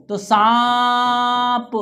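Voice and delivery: सांप drawn out slowly with the vowel through the nose; teacher showing the nasal sound in सांप; nasal vowel demo. Shown as a demonstration. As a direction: hold the nasal aa in सांप long. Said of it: "ना से बोलेंगे, नाक से बोलेंगे".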